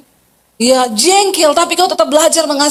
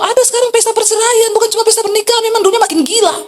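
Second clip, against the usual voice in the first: indignant exclamation; 8 semitones higher, faster than usual. Real, not acted. Outrage at divorce parties.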